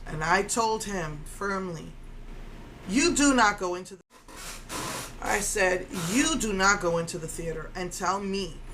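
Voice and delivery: ordinary talk, nothing marked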